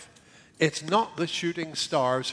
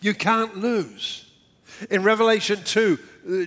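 ordinary delivery

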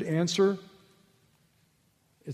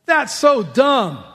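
blunt scorn; 7 semitones higher, 8 dB louder, slower than usual. Retold or imitated. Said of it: "Can I paraphrase that for you?".

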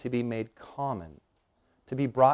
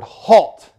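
barked military command, loud and high; manly Marine voice; 8 semitones higher, 13 dB louder than usual. Retold or imitated. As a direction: snap it like a drill sergeant. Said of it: "in their most manly Marine voice".

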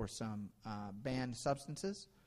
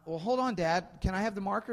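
put-on eager little boy; 8 semitones higher, 6 dB louder than usual. Son voiced in a story.

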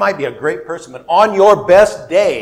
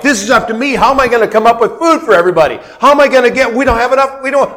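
higher, rushed mock panic; panicked Moses; 6 semitones higher, 4 dB louder, much faster than usual. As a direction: imitate Moses fretting about food, speeding up. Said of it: "Moses is going off like".

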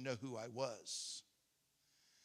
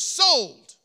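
a sudden, much louder and higher sold; shouted sold; 9 semitones higher, 20 dB louder than usual. Retold or imitated. Noni snaps up his tiny offer with a loud shout. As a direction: bang the word down like an auctioneer.

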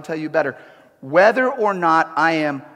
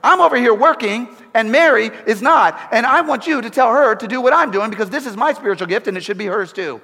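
higher, louder, fast bossy complaint; mock angry demand; 6 semitones higher, 5 dB louder than usual. Martha barking orders at Jesus, higher and louder mock anger. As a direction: bark orders in a hammed-up huff. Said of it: "She's like".

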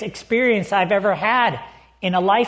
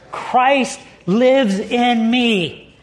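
very slow, each word weighed; solemn slow declaration; slow emphasis; much slower than usual. Real, not acted. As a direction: let every word land. Emphatic scripture reading.

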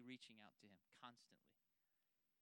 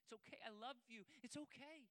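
tender hush; 8 semitones higher than usual. Acted. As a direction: whisper comfort into a frightened ear. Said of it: "let our Father grab you and hold you in his arms and say".

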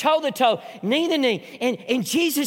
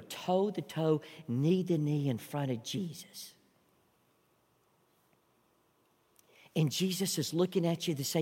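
much softer, drawn-in and intimate; hushed sermon voice; 6 semitones lower, 11 dB quieter than usual. Acted. Pastor guiding the congregation to picture Jesus, hushed. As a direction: pull them close and let them picture it.

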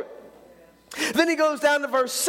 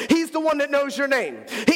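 normal talking voice

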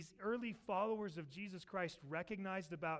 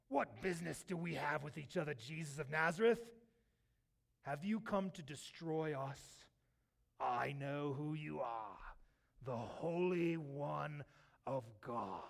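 demonic voice; 4 semitones lower than usual. Acted. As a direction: act the possessed man, dragging out each phrase. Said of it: "you hear this, this voice".

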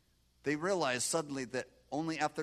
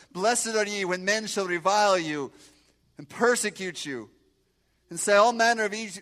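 loud, ringing scripture recital; preaching scripture; 7 semitones higher, 14 dB louder than usual. Acted. Preacher reciting the Beatitudes to the congregation. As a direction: proclaim it from the pulpit.

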